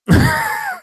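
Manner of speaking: short high burst of laughter. Real, not acted. Host laughs awkwardly about the director's death. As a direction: laugh up high, then stop.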